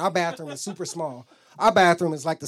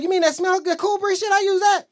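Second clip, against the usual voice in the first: high, loud, unabashed reply; brash admission; 11 semitones higher, 5 dB louder than usual. Retold or imitated. Answering his mad girlfriend about the soap, loudly unabashed. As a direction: fire back high, getting louder.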